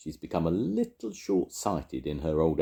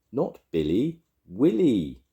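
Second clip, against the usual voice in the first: raised, louder correction; shouted correction; 5 semitones higher, 5 dB louder than usual. A narrator's reading. Willy correcting hard-of-hearing Grandma, moderate shout. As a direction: call it out, building to the name. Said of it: "he shouted".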